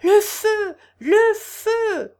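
very high, loud alarm cry; piercing cries of alarm; crying fire. A narrator's reading. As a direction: shout the alarm from downstairs. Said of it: "cria-t-on d'en bas distinctement".

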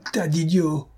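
high-pitched insult spat at the officers; furious. A narrator's reading. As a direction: hurl the insult in fury. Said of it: "et sa fureur redoubla".

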